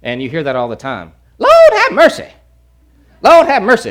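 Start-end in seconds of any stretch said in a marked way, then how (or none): 1.4-3.9 high, loud tutting exclamation; derogatory Lord have mercy. Retold or imitated. Pastor mimicking a Louisiana churchgoer, high and loud. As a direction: scoff it out like a scandalised church auntie. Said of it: "kind of more of a derogatory term".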